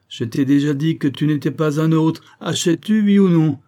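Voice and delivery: loud, high, insistent ultimatum; exasperated bullying, rising in force; impatient demand. Acted. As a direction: press hard, building to a shout. Benzouf pressures the reluctant buyer.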